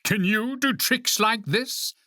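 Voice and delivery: high, slow boast; drawn-out proud challenge, high-pitched; crying out. A narrator's reading. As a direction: show off, stretching the words up high. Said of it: "cried Toad".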